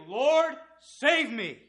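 high, loud plea for rescue; desperate cry. Acted. Peter sinking in the lake, crying out loud for help.

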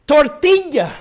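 "Tortilla" high and drawn out; insistent teacher modeling TORTILLA; coaching Spanish pronunciation. Retold or imitated. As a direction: urge it on with an exaggerated tor-TEE-ya. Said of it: "Now, come on".

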